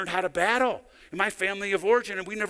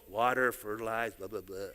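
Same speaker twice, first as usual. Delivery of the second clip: throwaway list; 11 semitones lower, 9 dB quieter than usual. Real, not acted. Dismissive list in a sermon.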